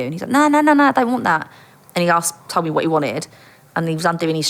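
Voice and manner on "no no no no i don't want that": high, quick-fire string of noes; acted protesting denial; imitated dismissal. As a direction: wave the idea away, pitched up. Dealer hastily denies wanting sex.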